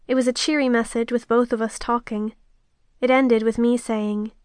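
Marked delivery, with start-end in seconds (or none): none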